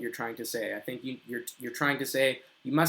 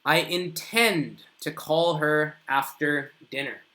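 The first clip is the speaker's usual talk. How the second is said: slow, deliberate model sentence; slow example sentence; 3 dB louder, slower than usual. Shown as a demonstration. Teacher reading an example to students, slow and deliberate. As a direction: lay out each word slowly for learners. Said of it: "So, examples".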